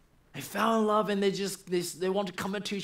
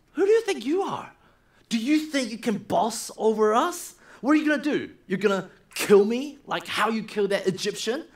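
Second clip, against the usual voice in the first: higher, louder challenging sneer; defiant higher shout at Moses; angry retort; 5 semitones higher, 6 dB louder than usual. Acted. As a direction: throw it back at him, indignant. Hebrew man confronts Moses.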